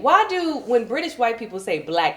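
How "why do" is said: ordinary delivery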